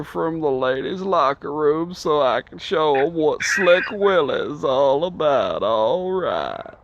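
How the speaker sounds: slow, drawled Clinton impression; Bill Clinton. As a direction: do your best Slick Willie. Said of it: "I gotta hear your Bill Clinton".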